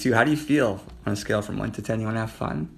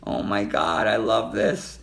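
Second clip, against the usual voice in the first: ordinary talk, nothing marked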